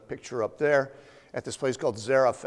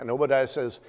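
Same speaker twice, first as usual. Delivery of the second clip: usual speaking voice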